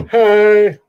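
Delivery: loud, high "hey" hollered in greeting; calling out greeting. Acted. Country guy greeting a stranger, loud friendly holler. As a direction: holler it to a passer-by.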